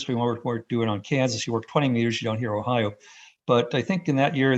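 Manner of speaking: ordinary talk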